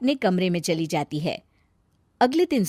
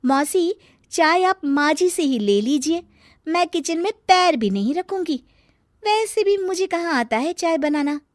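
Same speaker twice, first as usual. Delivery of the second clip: sulky retort; 7 semitones higher than usual. Acted. Daughter-in-law sulking retort.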